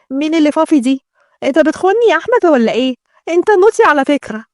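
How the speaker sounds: high-pitched pouty fiancée; coquettish bride. Acted. Bride accusing Ahmed of betraying her, playfully pouty. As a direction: play a spoilt bride teasing her man.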